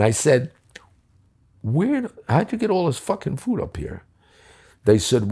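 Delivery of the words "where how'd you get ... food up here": much higher, incredulous question; laughing disbelief. Retold or imitated. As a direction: ask it half-laughing in disbelief. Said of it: "And I started laughing".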